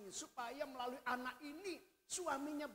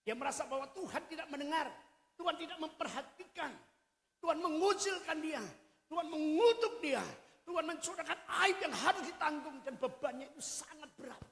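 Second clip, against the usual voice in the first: rising lament; 5 semitones higher, 5 dB louder than usual. Acted. Hannah's bitter feelings building.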